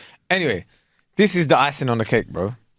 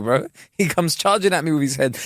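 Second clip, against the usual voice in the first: usual speaking voice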